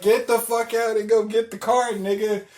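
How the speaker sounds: high, loud order; retold screaming. Driver ordering passenger to fetch his card, loud retold yelling.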